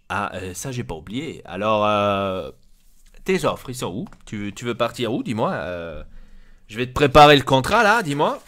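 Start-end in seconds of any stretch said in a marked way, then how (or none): none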